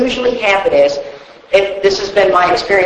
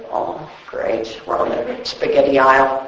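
much softer, lower, almost breathed aside; sarcastic inner thought; 7 semitones lower, 6 dB quieter than usual. Retold or imitated. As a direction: mutter it to yourself, dry and sarcastic. Sarcastic thought in grocery store.

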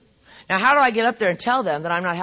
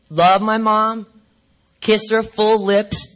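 ordinary delivery

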